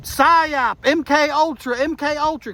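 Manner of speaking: high-pitched mock holler; mock yell. Witness hollering over the dead shooter, mock yell. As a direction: yell out a conspiracy chant, lightly. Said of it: "I just yelled out".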